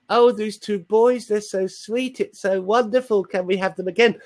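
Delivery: high-pitched, mock-adoring cooing; drawn-out, exaggerated high fawning; gushing listeners. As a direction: imitate listeners gushing over cute children in a high voice. Imitating gushing radio listeners.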